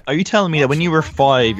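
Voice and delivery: usual speaking voice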